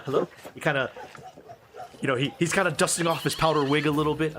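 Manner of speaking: ordinary talk, nothing marked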